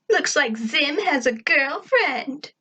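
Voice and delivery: high, slow schoolyard taunt; teasing. Acted. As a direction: draw it out to tease a classmate. Sarah teases in character voice.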